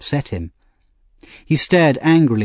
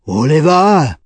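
loud, commanding call; deep-voiced call; 5 semitones higher, 6 dB louder, slower than usual. A narrator's reading. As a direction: summon him with booming authority. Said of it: "said in a deep voice".

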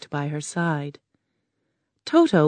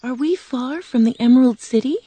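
put-on higher, girlish Dorothy voice; a worried little girl asking, pitched up; young girl Dorothy; 7 semitones higher than usual. Dorothy asking the Tin Man, girlish and higher.

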